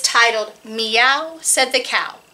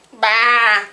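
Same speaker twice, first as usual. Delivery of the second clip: sheepdog bleating; 3 semitones lower, 4 dB louder than usual. Acted. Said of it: "He could only say".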